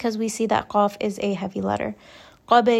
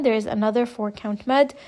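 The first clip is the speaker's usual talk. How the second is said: ordinary talk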